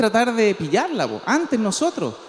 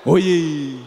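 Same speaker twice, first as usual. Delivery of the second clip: clueless friend; 8 semitones lower than usual. Acted. Clueless friend asks about at-sign.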